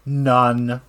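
ordinary talk

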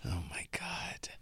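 quiet breathy whisper; hushed amazed whisper. Real, not acted. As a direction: whisper it in awe. Amazed reaction to story.

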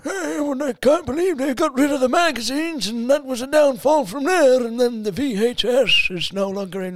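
put-on high, loud old man whine; whiny seedy old codger voice. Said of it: "seedy old men that are like".

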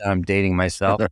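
normal talking voice

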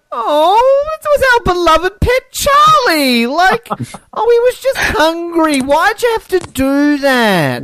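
high, hammed-up wail over the dead pet; shrill mock mourning for Charlie, slowing at the end; mock distraught henchmen. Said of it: "they're all, like, going".

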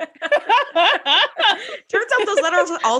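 slow words through laughter; laughing. Real, not acted. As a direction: say it while cracking up. Laughing at AOL joke.

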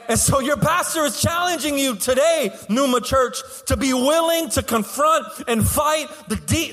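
high, driving sermon delivery; rally the congregation, pitched high and emphatic; preaching at full force. Real, not acted. Pastor challenging church to fight the enemy, fervent preaching.

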